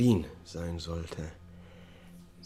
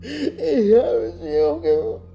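very high, very loud, slow howl of despair; wailing confession; 13 dB louder than usual. Acted. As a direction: let it tear out of you slowly. Repeats confession, louder wail.

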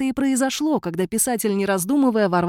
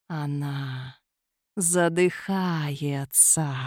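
very slow, soft ghostly hiss; ghostly whisper; 5 semitones lower, 4 dB quieter, much slower than usual. A narrator's reading. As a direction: breathe it out slowly like a ghost. Ghost voice in the air.

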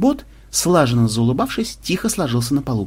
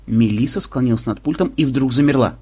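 ordinary delivery

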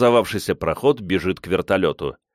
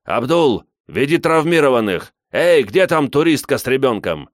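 loud, higher commanding call; urgent loud organiser's shout; shouted orders; 6 semitones higher, 5 dB louder than usual. A narrator's reading. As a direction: call out commands over a crowd. Murat shouting orders over crowd.

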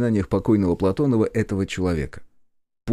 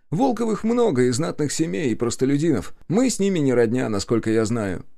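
higher-pitched cousin, brushing it off; dismissive cousin; 7 semitones higher than usual. A narrator's reading.

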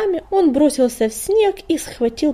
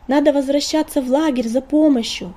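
higher, loud urgent shout; 4 semitones higher, 5 dB louder than usual. A narrator's reading. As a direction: call out over the storm. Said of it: "крикнула кошка".

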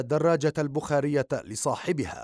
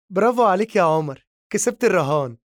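sarcastic, higher and louder applause; mocking bravo; 5 semitones higher, 4 dB louder, faster than usual. A narrator's reading. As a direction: gloat over his lost bet with scorn. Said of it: "ثم ضحكت بسخرية قائلة".

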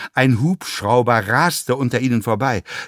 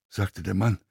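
plain reading voice

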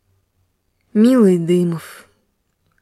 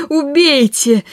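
a higher, hysterical repeat of the cry; shouting; 7 semitones higher, slower than usual. A narrator's reading. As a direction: scream it once more, beside herself. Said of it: "крикнула Ольга Ивановна". (истеричный крик)

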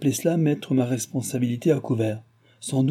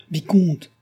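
high, louder call of alarm; crying out; 7 semitones higher, 5 dB louder than usual. A narrator's reading. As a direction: call out to stop him. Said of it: "cria la voix de Chon".

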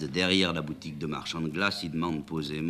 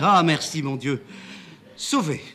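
high, loud relieved outburst; relieved cry; 11 semitones higher, 9 dB louder than usual. Acted. Amanda thanks the driver, relieved and loud. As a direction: gasp with relief at being saved.

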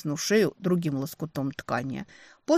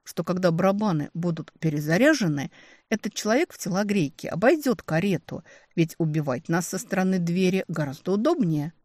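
ordinary delivery, nothing marked

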